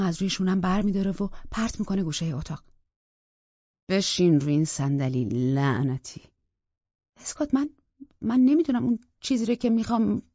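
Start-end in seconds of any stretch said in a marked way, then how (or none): 3.9-6.2 low, loud order to sit down; commanding. Acted. Scott forces her to sit. 7.2-10.2 higher, louder stammered plea; frightened pleading. Acted. Rachel pleads with Scott.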